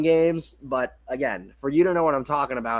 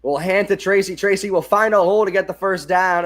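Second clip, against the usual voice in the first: lively sportscaster call, a bit higher and louder; play-by-play call; 4 semitones higher, 5 dB louder than usual. Acted. Calling the Giants drive for his viewers, live play-by-play. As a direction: call the handoff like a live broadcast.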